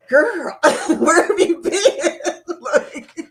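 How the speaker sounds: slow, laughing "where have you been"; greeting Danny while still laughing. Real, not acted. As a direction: tease a friend through laughter. Greeting friend after troll story.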